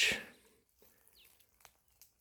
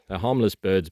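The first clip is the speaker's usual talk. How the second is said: normal talking voice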